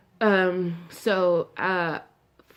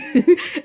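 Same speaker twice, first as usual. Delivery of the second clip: high loud laugh; 13 semitones higher, 10 dB louder than usual. Real, not acted. Laughing after calling herself crazy, loud high laugh.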